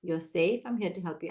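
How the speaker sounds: low, soft and calm; teacher calming a child who hit, softly; calm reassurance. Shown as a demonstration. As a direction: settle the child gently. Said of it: "I'm gonna respond calmly".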